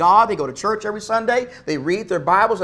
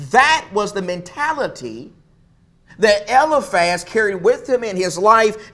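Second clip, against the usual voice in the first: ordinary talk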